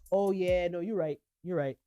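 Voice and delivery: low quiet mumbling retreat; sheepish backing down. Retold or imitated. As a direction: mumble it as you cave in.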